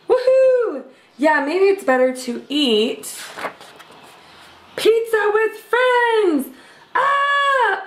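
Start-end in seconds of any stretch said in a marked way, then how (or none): 0.1-1.4 high loud cheer. Acted. Pizzas cheer at being spared, high and loud. 6.9-7.8 high startled squeal; cartoon shriek. Pizza shrieks at the vegetables on it, high.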